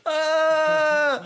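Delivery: sharp high cry of surprise; dad imitating his daughter's yelp as she is stopped; high startled yelp. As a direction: mimic the toddler's yelp, high and loud.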